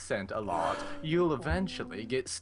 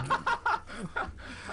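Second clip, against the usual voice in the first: silent wheeze laugh. Real, not acted. Friend laughing.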